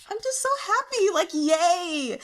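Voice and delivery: high, bright squeal of happiness; giddy joy. Real, not acted. Fan gushing to viewers about the story, excited squeal.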